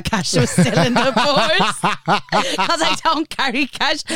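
slow words through laughter; laughing. Real, not acted. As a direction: giggle through the punchline. Laughing about tenner.

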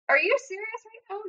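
high-pitched incredulous quote; retold disbelief. Friend reacting to her homecoming worry, incredulous.

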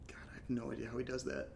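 soft whisper to himself; whispered aside. Real, not acted. Alone after his friend leaves, whispering to himself. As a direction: whisper it so no one hears.

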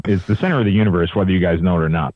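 fast proud aside. Real, not acted. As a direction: rattle it off quickly.

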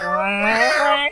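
high imitated meow; imitating a cat's meow. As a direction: mew like a hidden cat. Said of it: "It's like".

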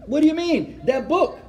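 high-pitched, incredulous comeback; mock-defensive protest; indignant retort. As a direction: answer back as if it's obvious. Retorts to doubt about his conversion.

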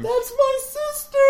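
high, slow hammed-up cry; mock yell. Acting out a victim of Rayquaza, high mock wail.

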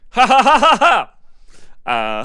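high, loud burst of laughing; loud laughter. Real, not acted.

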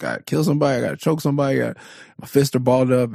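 high mock fighting talk; protective rage. Father ready to fight an intruder, high mock fury. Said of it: "I'm like".

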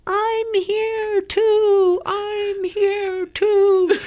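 put-on very high, very slow, tearful British-free child voice of an inner kid; a small child's voice, drawn out and tearful; little kid's voice. Inner child pleading to be heard, high and slow, near tears. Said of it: "this little kid's voice inside me going".

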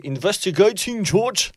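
high, slow mock Scottish accent. Host teases the guest's English, mock Scottish accent. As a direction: stretch it out slowly like a joking Scot. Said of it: "Tu vas avec l'accent écossais".

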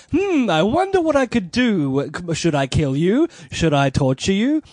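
scheming villain musing over options; mustache twirling villain. Retold or imitated. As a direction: toy with him like a cartoon villain. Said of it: "like, mustache twirling".

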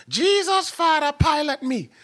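very high, loud mock plea to heaven; hammed-up, high-pitched cry for help; mom's exclaimed prayer. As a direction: wail it like a mom crying out to God.

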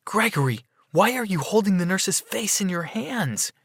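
high-pitched, incredulous question; shocked wife. A narrator's reading. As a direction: confront a husband in disbelief. Shocked wife confronts husband.

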